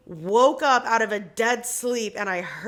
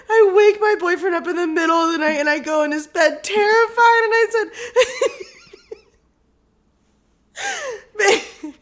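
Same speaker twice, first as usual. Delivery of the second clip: high, loud, breaking up with real laughter; laughing hard; 8 semitones higher, 4 dB louder than usual. Real, not acted. Telling how she woke her boyfriend, helpless laughter. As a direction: struggle to tell it through giggles. Cracks up retelling waking boyfriend.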